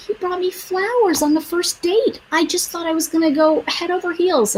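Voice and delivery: put-on high, swooning girlfriend voice, lifted well up; dreamy friend, mock swoon; gushing friend. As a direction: gush about him like you're smitten. Friend gushing about her date.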